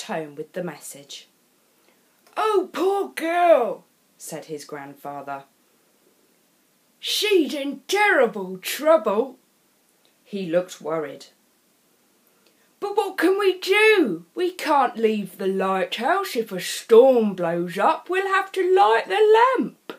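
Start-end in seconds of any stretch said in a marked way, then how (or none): 2.4-3.8 put-on slow, higher, louder sorrowful old man; an old man full of pity; a touch of a worried grandfather. A narrator's reading. Grandfather speaks. 7.0-9.4 put-on an old man full of pity; a touch of a worried grandfather. A narrator's reading. Grandfather speaks. 12.8-19.9 put-on louder, higher anxious old man; worried grandfather. A narrator's reading.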